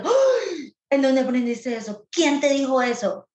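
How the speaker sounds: a mock-horrified mother grilling her child; alarmed parent. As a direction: play the shocked, panicky mom. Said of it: "En vez de".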